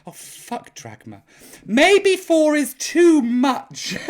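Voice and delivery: usual speaking voice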